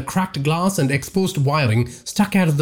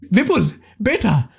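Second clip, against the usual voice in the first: a higher, eager call across the balcony; 9 semitones higher than usual. Acted. Tarek rushes to greet his son, eager call. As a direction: call to him as you rush forward. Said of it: "Tarek called out".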